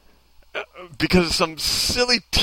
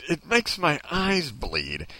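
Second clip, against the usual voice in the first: normal talking voice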